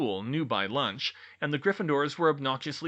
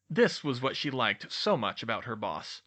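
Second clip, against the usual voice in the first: usual speaking voice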